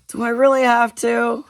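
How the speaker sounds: reluctant, impatient. Acted. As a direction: drag it out as if it's a chore. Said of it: "his voice sounding reluctant and impatient".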